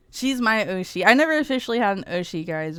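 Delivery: ordinary delivery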